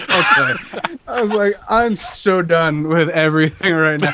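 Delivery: exasperated, laughing, slowed; laughing exasperation. Retold or imitated. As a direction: done with it all, laughing. Weary retold reaction.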